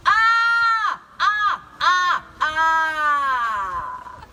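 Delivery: sudden loud witch shriek; startling boo. Acted. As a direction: jump at them with a loud ah. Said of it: "Did I scare you?".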